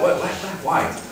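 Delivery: ordinary talk, nothing marked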